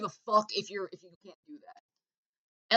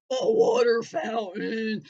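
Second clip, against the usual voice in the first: drawn-out deadpan answer; slow deadpan; 2 semitones higher, 4 dB louder, much slower than usual. Acted. Comic answering his own question, slow deadpan. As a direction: stretch it out flatly.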